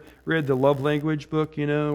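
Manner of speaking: ordinary delivery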